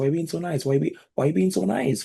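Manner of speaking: put-on very high-pitched whiny little boy; mock tantrum in a high whine; Lex Luthor. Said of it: "whiny little boy, like, screaming at Superman".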